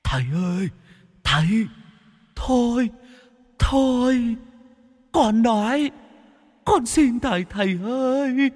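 very high, whining ghost pleading; whimpering ghost. A narrator's reading. Tortured ghost begs the exorcist to stop, whimpering. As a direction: beg in a wailing whimper. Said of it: "Nó mếu máo".

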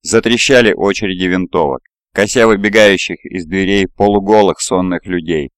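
ordinary delivery

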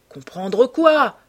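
slow, loud angry question; enraged. A narrator's reading. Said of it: "demanda-t-il rageur". (question rageuse, appuyée)

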